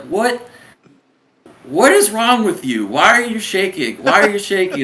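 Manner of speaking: high-pitched, incredulous mock scolding; hammed-up drill instructor grilling; berating officer. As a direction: imitate officers demanding answers from a recruit. Acted officers berating shaking recruit.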